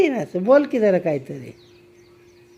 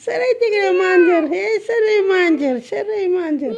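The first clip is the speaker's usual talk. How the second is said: whiny mock cry; 11 semitones higher, slower than usual. Real, not acted. Cat biting her, whines.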